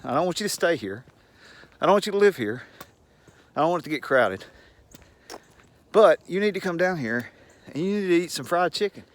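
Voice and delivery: ordinary delivery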